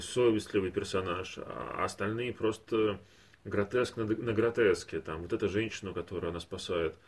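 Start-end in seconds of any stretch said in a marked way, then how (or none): none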